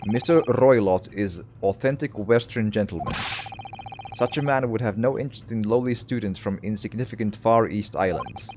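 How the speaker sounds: put-on lightly Russian-accented snobbish sailor. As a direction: dismiss them in a mild Russian accent. Sailor character voice.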